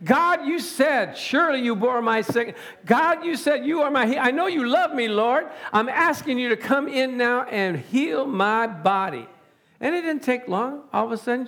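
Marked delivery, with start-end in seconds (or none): 0.5-9.3 urgent, higher-pitched pleading prayer; heart cry. Shown as a demonstration. Preacher voicing his wife's prayer for healing, heart cry. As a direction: plead with God in one driving run. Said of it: "how to cry from your heart".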